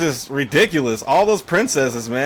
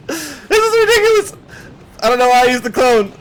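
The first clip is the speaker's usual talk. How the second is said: laughing disbelief; 11 semitones higher than usual. Real, not acted. Player laughing at the opponent's clone play, high laughing. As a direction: crack up while saying it.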